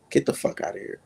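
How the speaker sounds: ordinary talk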